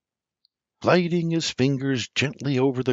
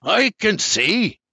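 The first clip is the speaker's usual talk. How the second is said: higher, louder, slow retort; irritated bark; 10 semitones higher, 4 dB louder, slower than usual. A narrator's reading. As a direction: brush him off gruffly. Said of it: "with a wave of his hand barks".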